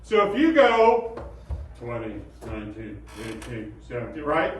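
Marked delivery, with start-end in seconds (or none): 1.8-4.2 lower, softer, lifeless counting; no-energy mumble. Shown as a demonstration. Teacher showing the wrong way to count. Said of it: "talk just with no energy".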